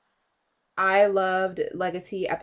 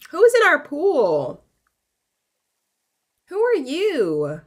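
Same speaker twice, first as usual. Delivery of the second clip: indignant shout; 8 semitones higher, 7 dB louder, slower than usual. Real, not acted. Stranger in the pool.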